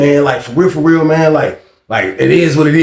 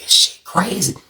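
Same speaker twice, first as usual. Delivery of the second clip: under the breath, amazed; whispering to himself while watching the scene; whispered aside; 3 semitones higher, 6 dB quieter than usual. Retold or imitated. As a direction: whisper in disbelief. Narrator muttering aside in disbelief.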